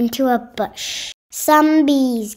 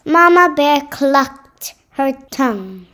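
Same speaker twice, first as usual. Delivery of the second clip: ordinary delivery, nothing marked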